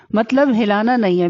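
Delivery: usual speaking voice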